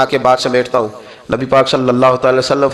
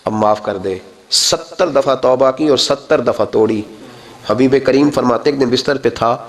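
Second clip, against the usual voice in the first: normal talking voice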